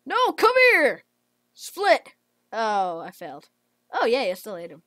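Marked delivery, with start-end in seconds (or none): 0.1-2.1 high, loud come here; real chasing shout; loud chasing call. Real, not acted. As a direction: shout after a fleeing bot. Chasing a bot.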